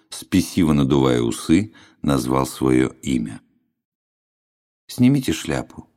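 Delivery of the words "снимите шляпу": colourless, toneless clerk; flat officious bureaucrat. Acted. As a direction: say it flat, without any interest. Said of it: "произнёс бесцветным голосом".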